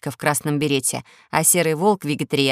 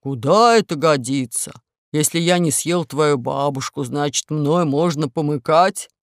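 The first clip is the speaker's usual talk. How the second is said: growling wolf; 3 semitones lower than usual. Acted. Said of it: "зарычал волк".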